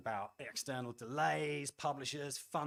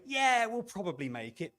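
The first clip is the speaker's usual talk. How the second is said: higher, louder, slow, breezy overconfidence; mock complacent; 8 semitones higher, 8 dB louder, slower than usual. Speaker mocks a studio head brushing off risk, breezy. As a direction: toss it off like nothing could go wrong.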